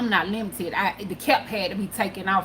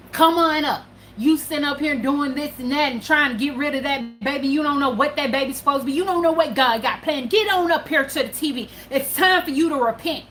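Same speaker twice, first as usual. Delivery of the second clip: higher, louder preacher's call, pressing her to come forward; preaching TV preacher; 6 semitones higher, 3 dB louder than usual. Retold or imitated. TV preacher summoning the suicidal mother to repent, loud and high preaching. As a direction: urge her up to the TV like a fiery preacher. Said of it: "And he was like".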